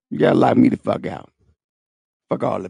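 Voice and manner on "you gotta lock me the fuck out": usual speaking voice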